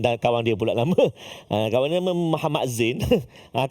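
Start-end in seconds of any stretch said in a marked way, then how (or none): none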